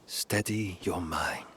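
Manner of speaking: ordinary talk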